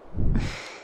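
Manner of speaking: brief breath of a chuckle; short chuckle. Acted. Dragoness amused by the human in her claws, chuckling. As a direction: let out a small chuckle.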